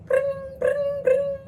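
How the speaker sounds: high trilled ringing noise; mimicked ringtone trill; imitated phone ring.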